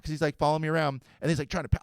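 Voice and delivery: ordinary talk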